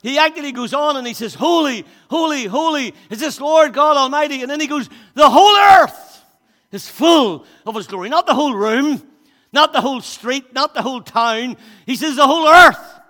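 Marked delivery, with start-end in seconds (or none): 1.4-4.4 proclaiming. Acted. Preacher proclaiming seraphs' cry. 5.1-8.1 shouting. Acted. Shouted proclamation. 12.1-13.0 shouting. Acted. Shouted again.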